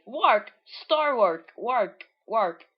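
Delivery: loud, high squawking; loud bird squawks, fading down. Acted. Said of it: "and he's like".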